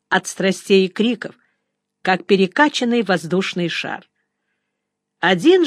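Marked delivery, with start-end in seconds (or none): none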